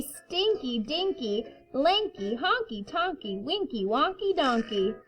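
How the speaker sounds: put-on reading to children. A narrator's reading. Reading picture book to kindergarten.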